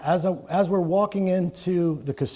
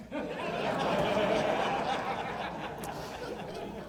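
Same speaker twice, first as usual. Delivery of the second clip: laughter; 8 semitones higher, 11 dB quieter than usual. Real, not acted. Laughing at own joke.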